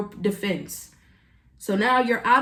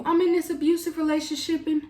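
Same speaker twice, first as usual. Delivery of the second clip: mock needy plea; 8 semitones higher than usual. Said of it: "they're coming to you telling you".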